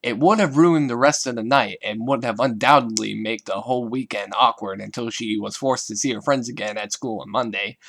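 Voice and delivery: plain reading voice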